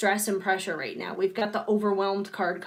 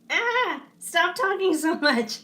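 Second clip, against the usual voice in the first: much higher, louder pleading whine; voice as a complaining character; 9 semitones higher, 3 dB louder, slower than usual. Retold or imitated. Streamer voices her own tired voice begging her to stop, high whiny plea. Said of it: "my voice is like".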